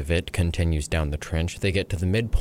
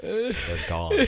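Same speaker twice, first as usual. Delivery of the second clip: ordinary delivery, nothing marked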